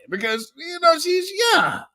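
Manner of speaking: high, mocking whine of a plea to the judge; mock whiny plea.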